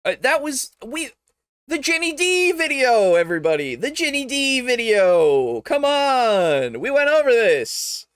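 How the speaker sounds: high and loud, pleading; you've told them this already, come on; exasperated reminder. Real, not acted. To everybody watching, exasperated, high and loud about the Jenny D video.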